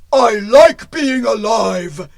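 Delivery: slow, loud stone golem; menacing golem. Acted. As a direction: boom it out slowly like a stubborn giant. Golem refuses to stop.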